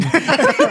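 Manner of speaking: loud high laugh bursting out; high burst of laughter. Real, not acted. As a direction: break into a squealing laugh. Laughs at own retelling.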